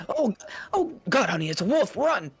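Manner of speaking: high, frightened shrieking; panicked scream. Acted. Voiced townsfolk fleeing the wolf.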